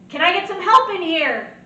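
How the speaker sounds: loud, high urgent call; urgent call for help. Shown as a demonstration. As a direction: yell for help down the hall. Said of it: "just like that in that tone of voice".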